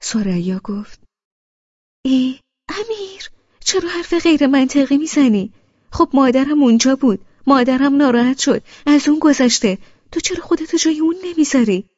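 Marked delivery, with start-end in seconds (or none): 2.1-11.9 high, loud sisterly reproach; rebuking. A narrator's reading. Sister rebuking brother loudly for his unfair words. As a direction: scold him, loud and firm.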